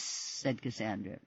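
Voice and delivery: plain reading voice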